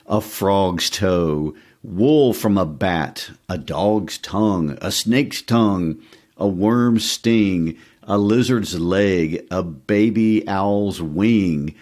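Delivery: relishing the recipe. Acted. Reading the grisly recipe slowly.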